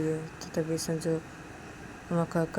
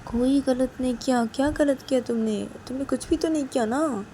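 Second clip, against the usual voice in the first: higher, coaxing concern; concerned Sanju; 6 semitones higher, 3 dB louder than usual. Acted. Sanju asking crying Uma what is wrong, concerned. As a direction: gently insist with anxious questions.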